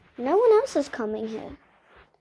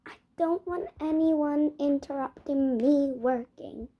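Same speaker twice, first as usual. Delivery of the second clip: ordinary talk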